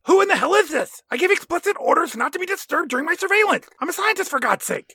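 high squeaky put-on indignant scientist; high cartoonish outraged voice; Scotty voice. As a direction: squeal it out, offended. Voicing Scotty in bit.

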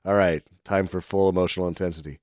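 mock wooden actor. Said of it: "he does it so shitty".

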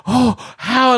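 gasp, then high astonished questions; shocked gasp. Retold or imitated. Psychic hears of the kidney cancer, high shocked gasp. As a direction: imitate her stunned disbelief, voice jumping high. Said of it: "she's like".

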